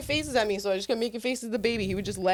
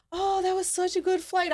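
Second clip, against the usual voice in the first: high, airy, chirpy mock relief; imitating a relieved flyer; 9 semitones higher than usual. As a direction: play the scared lady pretending all was fine.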